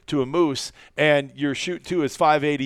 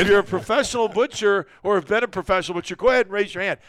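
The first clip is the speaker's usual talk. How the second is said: raised, higher-pitched address to everyone; retold comeback, pitched up and brisk; mock challenge to room; 6 semitones higher, 4 dB louder, faster than usual. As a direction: challenge the whole room, hammed up for the story. Acts out butcher challenging the room.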